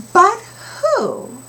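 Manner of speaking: chorus punchline, high and loud; high but who. Shown as a demonstration. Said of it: "Here goes".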